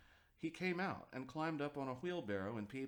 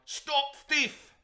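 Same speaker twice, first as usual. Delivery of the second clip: a loud, much higher furious yell after the thief; angry shout; 14 semitones higher, 13 dB louder than usual. A narrator's reading. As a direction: bellow it while chasing with a rake. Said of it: "calling out".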